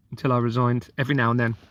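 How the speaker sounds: ordinary delivery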